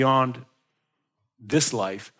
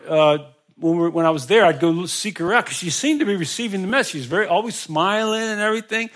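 ordinary delivery

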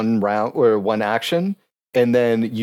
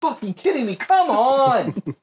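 frustrated; 11 semitones higher than usual. Real, not acted. Frustrated at a dice resistance roll.

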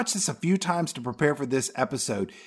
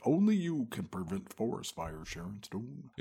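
usual speaking voice

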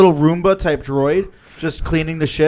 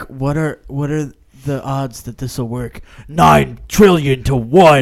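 mock movie gravity; 3 semitones lower, slower than usual. Imitating a movie character asking the odds, gravely slow. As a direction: weigh each word like a grave film line.

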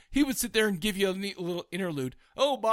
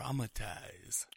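spooky drawn-out word; 9 semitones lower, 13 dB quieter, much slower than usual. Retold or imitated. Retelling spooky foreboding warning.

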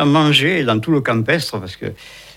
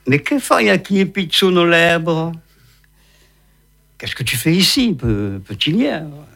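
sly, sweet-talking fox, higher; honeyed fox voice; 5 semitones higher than usual. Acted. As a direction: coax like a crafty fox. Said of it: "avec sa voix mielleuse de renard".

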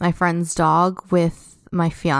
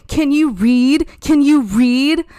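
mock taunt; 6 semitones higher, 5 dB louder than usual. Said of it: "she kept saying".

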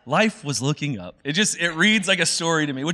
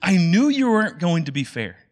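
bitter Jonah; 4 semitones higher than usual. Retold or imitated. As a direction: throw the accusation back at God. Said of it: "Jonah's like".

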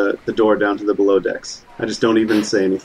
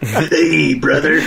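Macho Man Randy Savage; 5 semitones higher, slower than usual. Acted. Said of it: "Macho Man Randy Savage".

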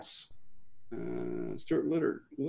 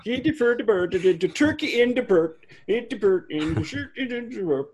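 high, loud mock Swedish chef singsong; 8 semitones higher, 4 dB louder than usual. Joking about turkey in the bird, high mock Swedish chef. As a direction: ramble like the Muppet chef.

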